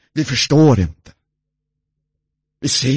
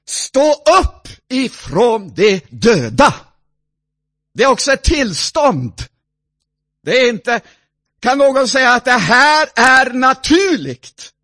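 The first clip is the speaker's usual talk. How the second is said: raised, louder exhortation; preaching; 10 semitones higher, 7 dB louder than usual. Acted. Preacher calling the dead to rise. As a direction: call them up out of death.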